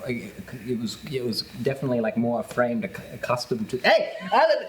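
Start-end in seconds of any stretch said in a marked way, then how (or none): 3.8-4.7 startled yell at someone; sudden shout. Pretend. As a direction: call out sharply, higher. Sudden calling out.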